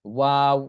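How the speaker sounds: long, loud ওয়াও ending on rounded, unclosed lips; don't let the lips meet at the end of ওয়াও; lips kept open. Shown as a demonstration. Teacher correcting learners, ওয়াও with lips left open. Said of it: "মুখ মিলানো যাবে না".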